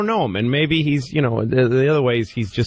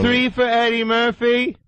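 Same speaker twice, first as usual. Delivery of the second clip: high, eager ticket request; mock moviegoer; 12 semitones higher than usual. Host mimicking a moviegoer buying tickets. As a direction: ask like a clueless fan.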